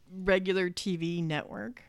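ordinary delivery

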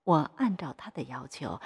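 usual speaking voice

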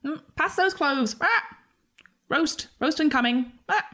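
high squawky creature voice; high, loud creature chatter; parroting creature. Acted. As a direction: squawk the words like a mimic bird. Game master voices parroting creature.

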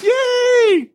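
put-on very high, loud child's squeal of "Yay!"; daughter's cheer. Imitating his youngest daughter cheering over Lucy, high squeal. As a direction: squeal like a little girl who is glad the dog is gone. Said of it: "my youngest daughter would be like".

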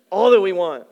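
a burst of loud, eager wanting; mock-excited crowd dreaming of free food. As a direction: imitate the crowd's greedy excitement. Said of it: "They're excited".

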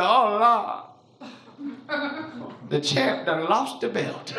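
put-on slow, drawn-out old lady lament. Old lady at the back after the knockout, slow lament. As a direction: imitate an old lady's weary pronouncement.

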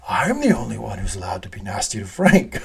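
hushed, breathy Logan line; a mock menacing whisper; imitated whisper. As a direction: whisper it like a possessive secret. Quoting Logan's possessive line.

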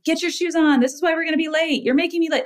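mock frantic scolding. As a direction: play a harried mom hurrying the kids. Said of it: "instead of yelling at them".

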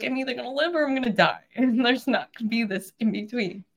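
high, tearful resolve; real emotion behind an ultimatum; emotional. Real, not acted. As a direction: rush it out, still choked up. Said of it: "I get emotional".

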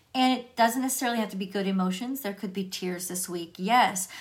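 normal talking voice

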